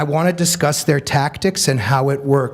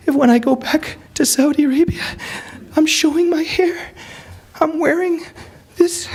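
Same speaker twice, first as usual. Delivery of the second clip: put-on much higher, anxious girlish voice; pleading girl. Hijabi girl explains her fear to the speaker, high anxious plea. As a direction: plead your case, scared. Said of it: "The hijabi girl".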